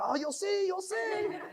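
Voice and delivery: high, laughing "you'll see, you'll see"; teasing excitement. Retold or imitated. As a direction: tease the wife, giddy and laughing. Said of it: "I was like".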